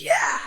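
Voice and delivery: very high cracked cry of pain; pained yelp. Retold or imitated. Imitating his yelp when shower water hit his wounds.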